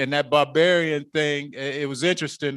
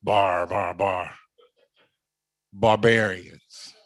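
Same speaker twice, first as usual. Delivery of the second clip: mocking babble; 6 semitones lower than usual. Preacher imitating Greeks mocking foreigners, lower babble. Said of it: "it sounds like this".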